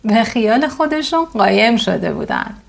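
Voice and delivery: ordinary delivery, nothing marked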